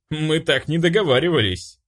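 wry, unbothered comeback; smirking. A narrator's reading. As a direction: protest with a smirk. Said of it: "усмехнулся я".

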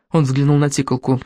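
plain reading voice

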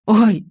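short, loud, high "ow"; sudden pained exclamation; cry of pain. A narrator's reading. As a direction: yelp as if suddenly cut. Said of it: "вскрикнул Джейкоб".

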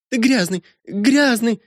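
put-on very high girlish revulsion; high-pitched childish accusation; girl's disgusted taunt. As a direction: spit the insult in a high little voice. Girl reviles her interrupted companion.

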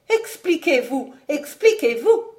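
high, agitated plea; anxious young woman forcing the words out; strained effort. A narrator's reading. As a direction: press for an answer, pitch well up. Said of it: "dit Alix avec effort".

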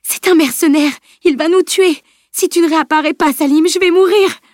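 high, loud desperate cry; anguished. A narrator's reading. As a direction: plead in terror for your life. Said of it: "toute l'angoisse qu'elle éprouvait".

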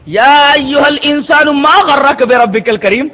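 slow, drawn-out verse recitation; Quranic recitation. Real, not acted. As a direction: recite it solemnly, stretching the long vowels.

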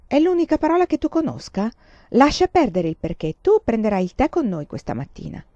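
mother scolding daughter's question, sharply. Acted. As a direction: cut her off impatiently.

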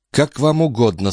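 humbled mumble. A narrator's reading. Police chief yielding to the countess, humbled mumble. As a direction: give in meekly. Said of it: "униженно пробормотал господин де Сардин".

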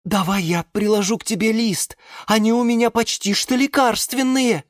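high-pitched helpful geranium; eager volunteer. A narrator's reading. (высокий услужливый голос)

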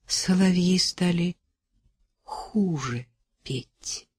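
slow, quiet, wistful; sincere sadness, trailing off softly; light sigh. A narrator's reading. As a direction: say it on a light sigh, letting it sink. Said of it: "сказал с лёгким вздохом".

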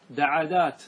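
دعدات in three syllables, second vowel copying the first; follow-the-first-vowel plural. Shown as a demonstration. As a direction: say دعدات with an "a" after the ع, da-ʕa-dat. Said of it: "تعطي الفاء حركة العين".

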